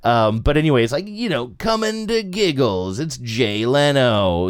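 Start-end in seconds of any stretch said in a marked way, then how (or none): 1.6-4.5 radio ad announcer. Retold or imitated. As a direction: drawl it like a cheesy radio ad. Mimicking radio comedy ad.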